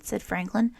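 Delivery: plain reading voice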